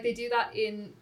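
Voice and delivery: normal talking voice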